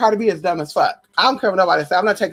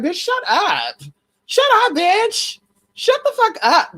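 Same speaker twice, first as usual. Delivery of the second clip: much higher-pitched, hammed-up scolding; exasperated outburst; 10 semitones higher, slower than usual. Vlogger telling off a rapper for how she talks, high exasperated outburst. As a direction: shut them down in high, mock exasperation. Exasperated retort to [name] talking.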